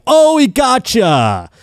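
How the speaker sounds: high, drawn-out mock taunt; heckling. Announcer heckling the batter who struck out, taunting. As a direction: imitate a gloating announcer, stretching it out in a high voice. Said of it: "he's, like, heckling the guy".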